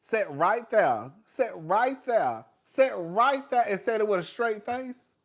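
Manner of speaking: slow, stretched, repeated emphasis; incredulous, swooping slow repetition; drawn-out indignation. Real, not acted. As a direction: drag out each "right there".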